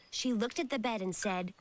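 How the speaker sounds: ordinary delivery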